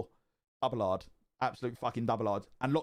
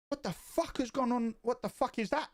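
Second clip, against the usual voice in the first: much higher, startled by the smash; shocked; 10 semitones higher than usual. Retold or imitated. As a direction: blurt it out in sudden shock. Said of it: "like, shocked".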